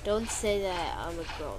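ordinary talk, nothing marked

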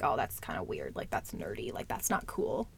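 hushed, scoffing imitation of her old self; dismissive inner voice. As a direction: mutter it like a self-conscious put-down. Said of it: "I was like".

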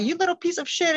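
mild mock outburst at a misbehaving son; frustrated outburst. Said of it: "I got so frustrated".

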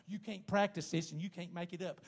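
usual speaking voice

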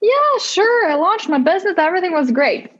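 high, singsong mock agreement; sarcastic. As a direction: gush it sarcastically, then let it fall. Mock answer, then says no.

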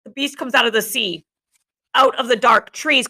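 ordinary delivery, nothing marked

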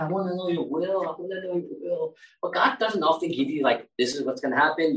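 ordinary talk, nothing marked

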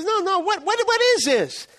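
pry eagerly; curious rich man. Retold or imitated. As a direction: rich man grabbing the list, high and loud. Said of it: "He goes".

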